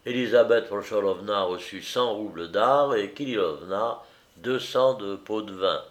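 usual speaking voice

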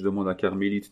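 usual speaking voice

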